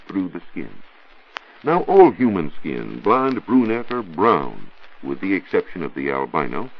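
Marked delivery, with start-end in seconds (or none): none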